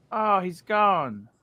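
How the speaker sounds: usual speaking voice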